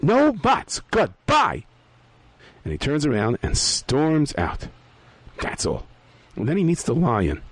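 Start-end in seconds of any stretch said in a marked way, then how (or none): 0.0-1.6 sharp dismissive voice. Acted. Character cutting off pleas.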